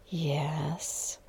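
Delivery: barely-there whispered yes; tiny voice. A narrator's reading. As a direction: shrink the word to almost nothing. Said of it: "My voice was impossibly small".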